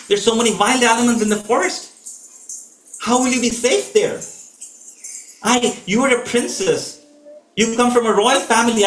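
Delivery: a husband listing the forest's dangers; lightly voiced urgent Ram; Ram pleading. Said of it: "Ram says that".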